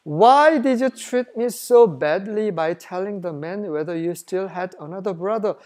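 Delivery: slow, measured Bible reading; scripture reading. Acted. Preacher reading the verse to the congregation, slow and measured. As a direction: read each English word out slowly. Reads scripture aloud slowly.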